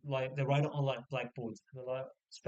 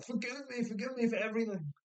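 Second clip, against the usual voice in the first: high-pitched hammed-up begging; mock pleading; 9 semitones higher than usual. Sick wife begging her husband's forgiveness, mock pleading. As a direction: beg melodramatically in a high voice.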